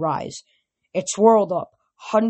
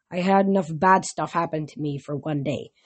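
usual speaking voice

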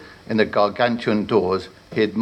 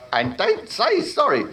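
slow, higher exasperated voice; exasperated warlock; 14 semitones higher than usual. Acted. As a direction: scold wearily like a vain wizard.

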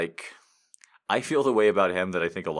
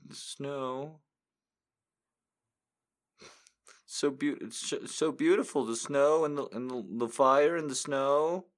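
high, vague, gushing viewer; mock dreamy fan; 6 semitones higher than usual. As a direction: play a pretend fan who never saw it. Mocking fake moviegoer answers.

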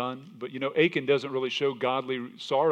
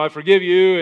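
mock Pharaoh; 7 semitones higher, 14 dB louder than usual. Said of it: "He would say, you know".

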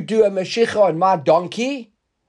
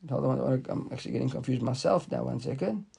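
much softer aside, lower; quiet aside; 6 semitones lower, 10 dB quieter than usual. Real, not acted. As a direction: drop your voice, talking to yourself. Confused teacher's muttered aside.

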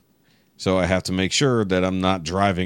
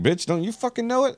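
much higher mocking jab, rising on know; sarcastic retort. Retelling his comeback to yelling cyclists, high mocking sneer. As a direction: sneer it back high and pointed. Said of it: "It's like".

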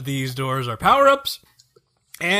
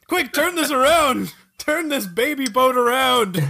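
mock panic; 7 semitones higher than usual. Joke panic about baby boat.

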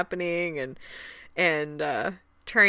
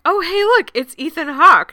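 high, loud excited call; excited spotting; 8 semitones higher, 8 dB louder than usual. Retold or imitated. Friend spotting the actor outside the hotel, excited. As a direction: point him out with a thrill. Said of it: "she's just like".

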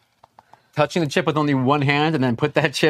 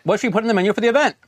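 very fast, rushed line read; 3 semitones higher, much faster than usual. Real, not acted. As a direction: race through the line to catch up. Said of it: "it's your line".

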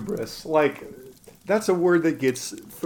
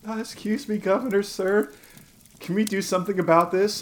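higher, meek imitation of a boy; mock-polite plea in a raised voice; burned boy petitioning governor; 6 semitones higher than usual. Burned boy interrupting the governor's speech, mock polite. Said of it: "Strolls into, like, the stump speech covered in".

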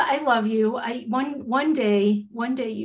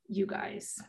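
near whisper; 12 dB quieter than usual. Real, not acted. Missing friends, voice drops.

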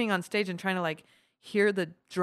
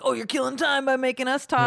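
heckler; 6 semitones higher, 4 dB louder than usual. Retold or imitated. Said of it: "I remember getting heckled like that".

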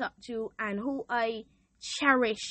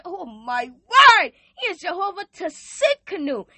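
building louder, crying out names of God; rising preacher fervor; 2 semitones higher, 7 dB louder than usual. Real, not acted. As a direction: preach it, climbing to a cry. Building praise declaration.

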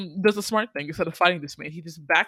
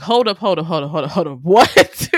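loud rapid-fire protest, jumping up on the last word; mock disbelief; 11 dB louder than usual. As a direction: interrupt in hammed-up shock. Acts out Johnny's shocked objection.

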